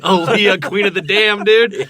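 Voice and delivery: high, loud fan gush; excited shout. Real, not acted. Gushing over [name]'s role.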